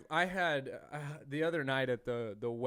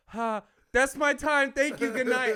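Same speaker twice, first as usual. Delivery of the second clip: high, loud stage farewell; hurried sign-off, retold; 10 semitones higher, 12 dB louder than usual. Embarrassed new comic to the crowd, loud panicked sign-off. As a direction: re-enact bailing out of a set.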